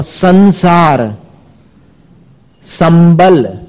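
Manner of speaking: संसार with an n sound, संबल with an m before ब; teacher contrasting nasal sounds; anusvara contrast. Shown as a demonstration. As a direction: say संसार and संबल slowly so the nasal changes are clear. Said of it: "कितना अंतर है".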